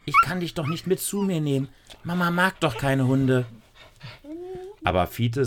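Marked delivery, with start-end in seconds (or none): 0.0-3.5 put-on worried girl. Lisa worried talking to dog.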